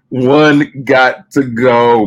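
very slow stretched announcement; drawn out slowly. Pretend. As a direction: stretch "One got to go" out. Playfully repeating game title.

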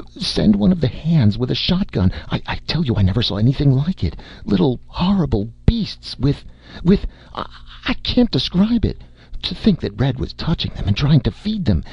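high, stammering panic; hysterical fright. Acted. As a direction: babble it out, shaken to the core. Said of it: "She had not entirely recovered".

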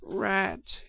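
high, soft moan. A narrator's reading. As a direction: moan his name in despair. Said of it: "he moaned".

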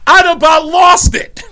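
loud, high, slow outburst; exasperated outburst. Retold or imitated. Wife recalling the husband's remark, exasperated outburst. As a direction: push it high and loud, nearly losing it. Recounting her shock, breaking up.

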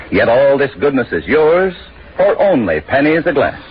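ordinary talk, nothing marked

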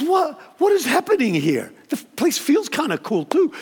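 a man baffled that the house feels this calm; puzzled surprise. Retold or imitated.

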